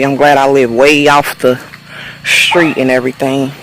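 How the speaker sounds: ordinary talk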